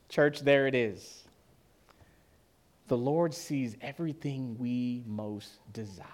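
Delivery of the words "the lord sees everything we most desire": hushed, breathy whisper. Real, not acted. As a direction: drop to a whisper to draw the church in. Intimate turn after scripture reading.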